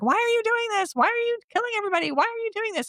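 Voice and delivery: very high, frantic squeal; hammed-up terrified wail; mock screaming. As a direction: shriek it like a panicked victim who won't move. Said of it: "and he's screaming".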